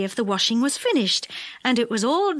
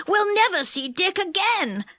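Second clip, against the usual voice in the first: Moonface; 9 semitones higher than usual. A narrator's reading.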